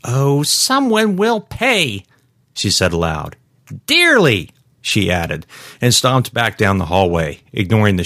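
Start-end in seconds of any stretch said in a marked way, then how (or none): 0.0-2.0 high, slow, drawn-out indignation; flaring temper. Acted. Trapped shopper vowing payback at a locked door, indignant. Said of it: "both her temper and fear flared". 3.9-4.5 high-pitched indignant addition; flaring temper. Acted. Trapped shopper adding to her threat, indignant. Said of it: "both her temper and fear flared".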